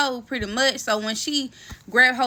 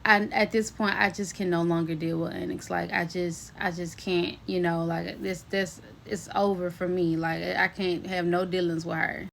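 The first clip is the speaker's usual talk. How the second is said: low, soft, defeated voice; distraught, retold; 5 semitones lower, 7 dB quieter than usual. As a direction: quietly imitate someone who has had enough. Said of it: "like, distraught".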